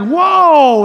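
loud, drawn-out mock shock; startled whoa. Boy suddenly hit in the face, startled. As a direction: blurt it as if just hit. Reacting to a punch.

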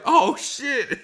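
high, startled oh shit as the clumsy guy; a sudden high yelp of oops; panicked yelp. Retold or imitated. Buddy kicks Jeff in face.

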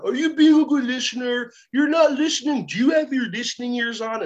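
put-on high, sing-song talking down to a child; hammed-up preschool teacher reprimand; scolding teacher. Adult scolding child, acted.

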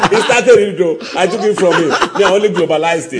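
giggling through the words; laughing. Real, not acted. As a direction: tease him while laughing. Teasing while laughing.

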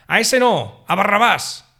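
loud, high shout of the crowd. A narrator's reading. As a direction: yell it out as the angry mob. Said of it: "Volvieron a gritar". (la multitud gritando fuerte)

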